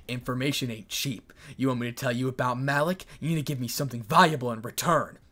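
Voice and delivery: usual speaking voice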